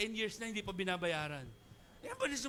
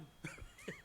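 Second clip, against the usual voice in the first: high burst of laughter; 13 dB quieter than usual. Real, not acted. Laughs at own joke.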